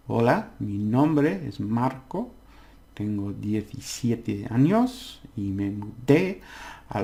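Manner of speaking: slow, clear model reading of the Spanish passage; slow careful reading. Shown as a demonstration. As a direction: read it out slowly for learners. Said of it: "try and read it".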